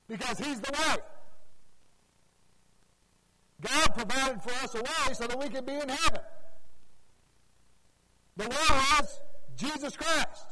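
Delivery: loud, high, hammering pulpit climax; thundering gospel preacher; preaching at full force. Acted. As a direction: proclaim it to the whole church.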